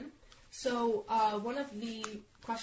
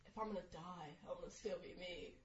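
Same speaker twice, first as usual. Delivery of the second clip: very soft, slightly lowered imitation; imitation attempt; 3 semitones lower, 15 dB quieter than usual. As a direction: attempt his voice very quietly, under a cold. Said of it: "Don't make fun of him".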